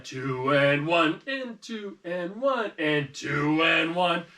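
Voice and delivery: counting very slowly and deliberately; teacher counting two four slowly; slow counted beat. Shown as a demonstration. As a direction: stretch each count out slowly. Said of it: "we're in two four".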